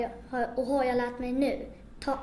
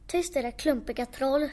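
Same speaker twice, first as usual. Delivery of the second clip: ordinary delivery, nothing marked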